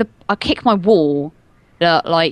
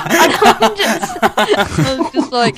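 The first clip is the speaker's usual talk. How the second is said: laughing, high and slow; telling the story while cracking up; 6 semitones higher, 5 dB louder, slower than usual. Real, not acted. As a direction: talk through a laugh.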